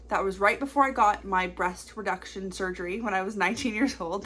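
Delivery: usual speaking voice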